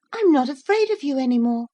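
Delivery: proud new courage; high fast boy voice, brave; bold little boy. A narrator's reading.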